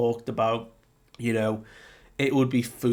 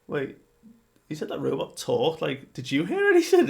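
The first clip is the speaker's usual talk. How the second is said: much higher, rising confused giant voice; puzzled giant; 5 semitones higher, 4 dB louder, faster than usual. Retold or imitated. Giant asks his friend if he heard the robot, high and baffled. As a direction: squeak the question in disbelief. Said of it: "the giants are going".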